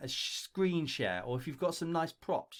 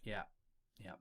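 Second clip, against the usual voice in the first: mock careless mumble, softer and lower; lazy intro; 7 semitones lower, 3 dB quieter than usual. Teacher mocking a careless applicant's intro video, bored mumble. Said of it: "Don't just be like".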